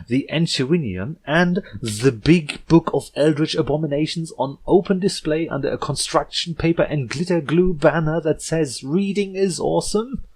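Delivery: frantic exasperation. Acted. Yearling ranting at the princess, loud and frantic. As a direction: pile the list up in disbelief. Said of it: "extreme agitation, verging on panic".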